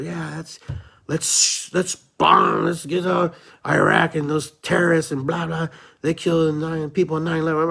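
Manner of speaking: loud, high gung-ho war cry; rally the crowd to bomb; gung-ho hawk. Retold or imitated. Mocking his past self cheering for war, loud and high. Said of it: "I was on that bandwagon too".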